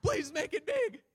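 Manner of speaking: hammed-up high bellow; Pastor Tim. Preacher parodies his colleague out for coffee, mock shouting. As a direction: shout an everyday request as if preaching at full force. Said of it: "He doesn't talk like that".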